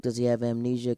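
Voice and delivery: ordinary delivery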